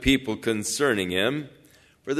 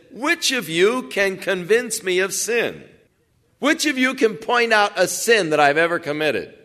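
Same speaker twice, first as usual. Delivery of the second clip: higher-pitched, challenging rhetorical question; open challenge; 7 semitones higher than usual. Retold or imitated. Jesus challenging them in a dispute, raised, challenging voice. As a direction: throw it out as a dare to the accusers.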